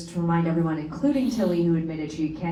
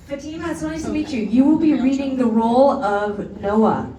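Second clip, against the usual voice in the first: bright, loud host voice, pitched up; kids show host; 7 semitones higher, 9 dB louder than usual. Acted. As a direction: welcome the volunteer like a cheery TV host. Onstage host greeting a volunteer.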